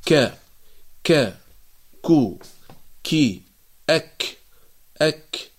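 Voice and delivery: ك then كو, كي, أك said as isolated sounds; ك كو كي أك drilled one by one; letter kaf sounds. Shown as a demonstration. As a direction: sound out the kaf from the back of the tongue. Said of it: "فنقول".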